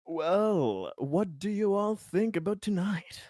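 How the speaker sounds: genial host. Acted. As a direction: open it up to the table with a lilting question.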